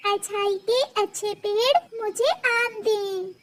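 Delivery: high, soft hopeful wish; hopeful little bird. Acted. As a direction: whisper your wish with eyes closed. Little bird wishes with eyes closed.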